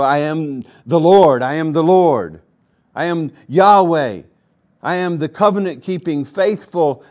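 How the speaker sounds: God's proclamation. Acted. Preacher voicing God to the congregation, in a loud booming voice. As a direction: thunder out God's self-naming. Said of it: "repeats it for emphasis".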